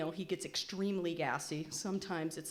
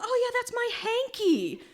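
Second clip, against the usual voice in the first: much higher, slow, laughing dad voice; dad's voice; 7 dB louder than usual. Retold or imitated. Dad cheerfully showing security his hanky, high and laughing. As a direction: play the clueless dad, amused.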